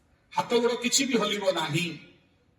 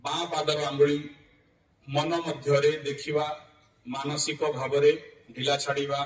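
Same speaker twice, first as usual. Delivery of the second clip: low-key, even meditation voice with long pauses; guided yoga nidra; 4 semitones lower than usual. Real, not acted. Yoga teacher guiding students to relax from the feet up, guided meditation. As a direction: guide them through the body, unhurried.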